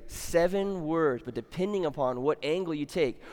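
normal talking voice

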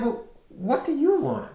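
high sassy "what do you want"; annoyed. Retold or imitated. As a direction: brush him off with attitude. Answering door to unwanted ex.

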